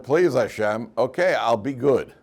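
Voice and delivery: loud, desperate plea; pleading prayer. Retold or imitated. Sick man begs God to spare him, loud pleading. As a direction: beg as if at gunpoint.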